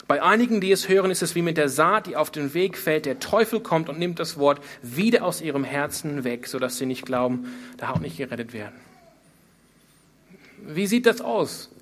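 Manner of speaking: ordinary delivery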